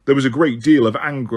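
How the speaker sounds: ordinary delivery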